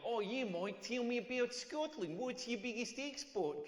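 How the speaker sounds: put-on slowed, drawn-out Australian accent, lightly done; imitating his Australian flatmate with a mild Australian accent. Comic telling of his Australian flatmate, in a light Australian accent. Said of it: "that's exactly what Australians sound like".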